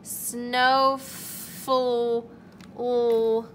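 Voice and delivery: Snowfl- cut off, then l alone; sounding out snowflake to the l. Shown as a demonstration. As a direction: stretch to the l and hold it. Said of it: "keep saying the word with each letter".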